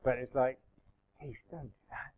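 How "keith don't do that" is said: hushed, let-down "come on" tone; gently chide, much softer, slightly raised pitch; soft, weary reproach. Shown as a demonstration. Pastor showing another tone to Keith, soft chiding. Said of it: "that's a different tone of voice".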